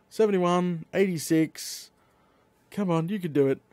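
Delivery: usual speaking voice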